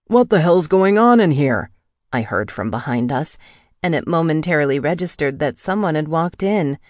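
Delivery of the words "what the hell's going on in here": raised, louder intruder's voice; startled outburst. A narrator's reading. Intruder walking in on them, loud startled demand. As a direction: burst out higher and louder.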